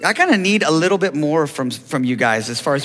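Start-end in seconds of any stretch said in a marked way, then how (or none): none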